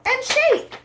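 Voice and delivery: squeaky shake. Acted. Shaking the book.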